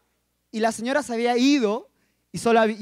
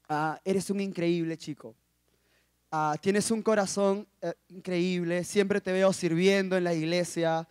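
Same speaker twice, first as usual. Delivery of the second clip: lower, soft, measured kindness; gentle compliment; 4 semitones lower, 5 dB quieter than usual. Retold or imitated. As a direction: let someone down gently, softly. Voices the girl's kind speech.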